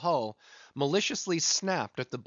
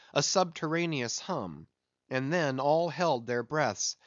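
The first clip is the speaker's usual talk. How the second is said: ordinary delivery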